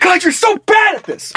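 mock breakdown. Robber self-scolding breakdown.

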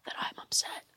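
hurried, secretive whisper; upset whisper. Acted. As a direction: keep it from your lady, whispering fast. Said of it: "Why are we whispering it?".

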